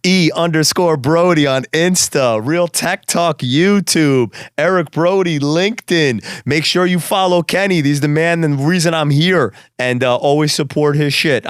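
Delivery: promotional plug. Real, not acted. Guest promoting his socials and the host, slow promo delivery. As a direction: draw out each handle for the listeners.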